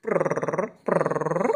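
soft rolled purr; cat purring. Retold or imitated. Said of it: "se llama ronroneo".

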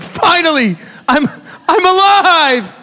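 high, slow, breathless relief; Little Mermaid surfacing. Retold or imitated. Coming out of the rapid like the Little Mermaid, breathless joy. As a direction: gasp it out like a mermaid breaking the surface. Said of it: "like the Little Mermaid".

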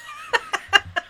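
a high squeal of laughter. Real, not acted. Laughing at his own gross story, high squeal. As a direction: burst out in a squeaky giggle.

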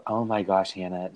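normal talking voice

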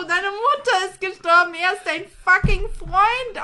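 a retold friend's indignant scolding. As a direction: scold her, half laughing. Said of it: "Und sie so".

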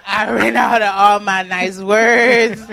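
half-laughing mock meltdown after a long day; exasperated loud rant. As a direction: vent like you have nothing left for anyone. Acting out tired self at home.

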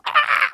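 short, high-pitched yelp; flustered yelp. Retold or imitated. Acting out flustered fumbling with phone.

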